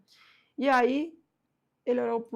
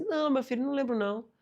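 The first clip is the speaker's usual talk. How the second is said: put-on higher old man gently denying; 7 semitones higher than usual. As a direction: answer like a kindly old man. Old man answering apprentice. (senhorzinho respondendo com carinho)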